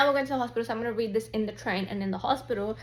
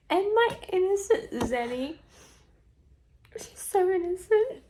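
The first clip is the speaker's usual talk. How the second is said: gushing whisper; 9 semitones higher than usual. Real, not acted. Gushing over innocent character.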